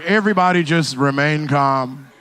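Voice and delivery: low, drawn-out pilot announcement; imitating a calm pilot. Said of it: "the pilot was like".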